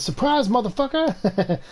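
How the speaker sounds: high, drawn-out catchphrase; spring it on them like a TV meme; meme catchphrase impression. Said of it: "He just says".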